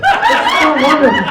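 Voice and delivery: high, swooning mock gush; gushing uncle impression. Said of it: "my uncle talking like this".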